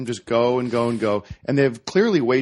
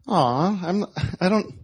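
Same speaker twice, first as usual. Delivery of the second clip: higher, pitying "aw" from a crowd; reluctant sympathy; 7 semitones higher than usual. Retold or imitated. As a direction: imitate a softhearted audience hesitating to object. Voices a reluctant, sympathetic audience.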